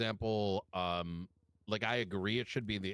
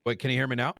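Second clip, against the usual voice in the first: louder, projecting to the room; to someone hard of hearing; 3 semitones higher, 6 dB louder, faster than usual. Real, not acted. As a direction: speak up so they can hear. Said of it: "Hey, can you speak up".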